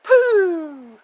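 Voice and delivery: high, loud puffed "Pooh!" like the YouTuber's sign-off; mock pooh sound. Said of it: "he goes".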